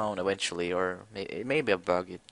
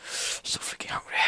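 whiny whisper; 7 dB quieter than usual. Real, not acted. Whines about hunger.

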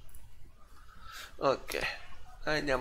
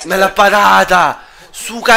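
exasperated shout; 4 semitones higher, 9 dB louder than usual. Real, not acted. Penalty saved, reacting.

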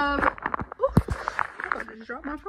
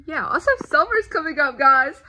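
loud yell to the room; 3 semitones higher, 9 dB louder than usual. Real, not acted. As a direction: shout it out. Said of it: "Ooh, I just yelled".

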